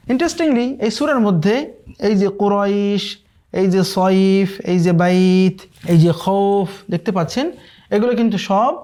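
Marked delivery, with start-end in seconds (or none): none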